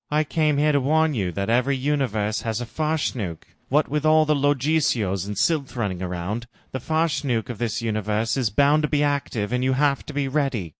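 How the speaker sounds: warning Jim. Acted. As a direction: urge her to be ready. Jim warns Laura.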